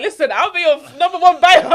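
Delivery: loud laughing enthusiasm; excited. Retold or imitated. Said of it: "I'm like".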